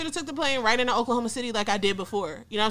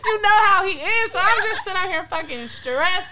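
laughing; 8 semitones higher, 5 dB louder than usual. Real, not acted. Laughing at the brother's reaction.

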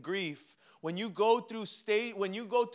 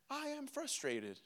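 high, soft robotic monotone; 6 semitones higher, 11 dB quieter than usual. Retold or imitated. Husband newly naming his emotions, robot voice. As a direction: say it like a stiff little robot. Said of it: "I sounded like a robot saying it".

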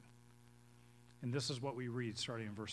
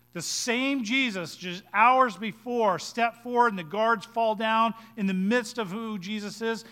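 loud, high, emphatic sermon delivery; impassioned preaching; 8 semitones higher, 14 dB louder than usual. Real, not acted. Preacher on the same Jesus now being tortured, impassioned. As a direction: drive each phrase home at full voice.

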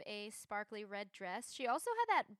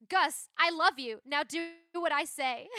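bossy Maddie quote; 4 semitones higher, 11 dB louder than usual. Retold or imitated. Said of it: "she just says".

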